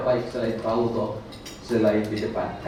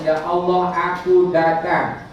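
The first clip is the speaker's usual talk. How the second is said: loud, slow cry of "aku datang"; slow, loud "Ya Allah, aku datang" to God; fervent declaration; 5 semitones higher, 8 dB louder, much slower than usual. Shown as a demonstration. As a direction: declare it with full heart. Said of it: "Dua kali".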